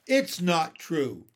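loud, slow denial; emphatic boyish protest; disbelieving. A narrator's reading. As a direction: flatly refuse to believe it, stretching it out. Boy refuses to believe claim.

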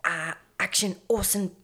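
very soft, hesitant prompting; falter, then almost swallow the please; meek little director. Retold or imitated. Director cueing a motionless Orson, timid and halting. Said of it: "that kind of fucking stupid".

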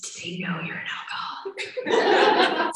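scandalized, confidential whisper; hushed whisper. Retold or imitated. Patient asking counselor about her daughters knowing, whispered. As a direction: whisper it slowly like a shameful secret.

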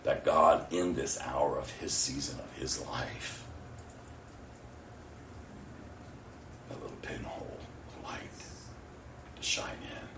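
low, soft pleading prayer; hushed prayer. Retold or imitated. Praying for the mother's boyfriend, hushed. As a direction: pray it low, sinking toward a whisper.

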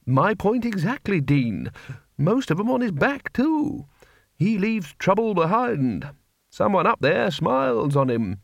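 put-on high, wavering old wizard; a fussy elderly don making a point. Wizard argues in story.

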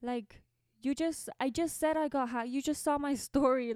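high, chirpy mock friend voice, laughing at the end; mock helpful friend. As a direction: ham up the well-meaning friend. Friends' helpful texts mocked.

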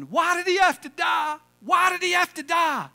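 high, loud shouted lament; mock yelling. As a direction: wail it across the graveyard. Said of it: "he kept yelling".

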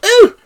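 high delighted squeal with a laugh; a Queen's startled high ooh; high surprised ooh, then a giggle; imitated squeal. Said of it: "and she went".

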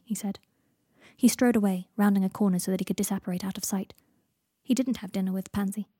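plain reading voice

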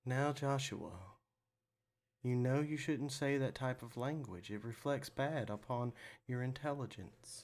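mildly voiced monk, soft and measured; a hint of a gentle, scolding monk; monk style. Host voices his too-nice double scolding Joshua, softly monk-like. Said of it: "straight up, like, you know, monk style".

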